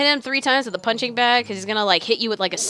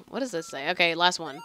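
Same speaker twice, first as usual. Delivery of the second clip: ordinary talk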